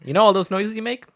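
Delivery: playfully sarcastic reminder to a friend; mock friendly confrontation. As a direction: pretend to be sweet while telling him off. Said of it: "I was like".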